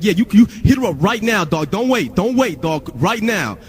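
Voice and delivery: high, forceful scolding; hammed-up urgent commands; bossy label guy. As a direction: imitate an indignant dude ordering an apology. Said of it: "This is what he was saying".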